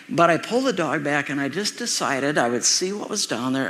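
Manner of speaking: ordinary talk, nothing marked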